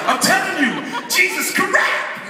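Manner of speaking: high, loud, eager pitch to his friends; half-laughing, shouted conviction; excited insisting. Retold or imitated. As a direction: insist like it's a revelation.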